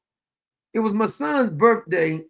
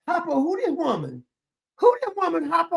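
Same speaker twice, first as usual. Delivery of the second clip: high-pitched baffled questioning; hammed-up disbelief, pitched way up; mock bewilderment; 9 semitones higher than usual. Asking who this woman is, mock bewildered. Acts out bewilderment at her accent.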